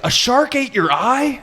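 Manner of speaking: put-on high slow astonished kid; amazed kid. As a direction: gape at it like a wide-eyed kid. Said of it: "Brandon's like".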